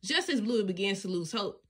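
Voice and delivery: ordinary delivery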